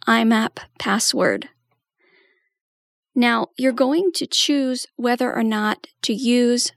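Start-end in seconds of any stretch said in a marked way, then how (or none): none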